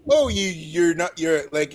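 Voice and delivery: usual speaking voice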